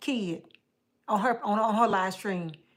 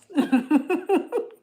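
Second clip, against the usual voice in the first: high giggle; 7 semitones higher, 5 dB louder than usual. Real, not acted. Laughing at own flirting.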